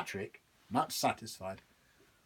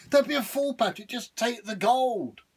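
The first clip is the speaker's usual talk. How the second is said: high, louder devil snapping at a fool; pushy devil; 12 semitones higher, 8 dB louder than usual. Acted. Devil scolding Patrick to take the gold. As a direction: press him hard to take it.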